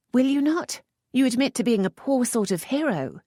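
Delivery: a touch of mockery; lightly voiced teasing lady. A narrator's reading. Lisette teasing the earl, lightly arch.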